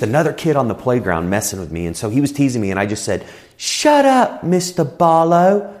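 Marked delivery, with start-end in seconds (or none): 3.6-5.7 high, drawn-out English schoolboy retort; cheeky kid with an English accent, pitched way up. Retold or imitated. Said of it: "hence the accent".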